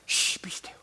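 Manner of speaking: put-on whispered hush to a child; a whispered shush from a stern father. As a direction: hiss it under your breath in church. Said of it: "And he said".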